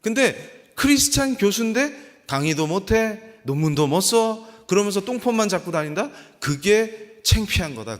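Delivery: loud, high, driving sermon delivery; preaching at full force. Acted. Preacher insisting any job done for God is noble, impassioned preaching.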